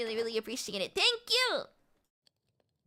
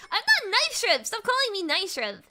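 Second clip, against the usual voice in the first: higher, louder playful indignation; mock protest; 9 semitones higher, 4 dB louder than usual. Real, not acted. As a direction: push back on the teasing nickname. Protesting a nickname from chat.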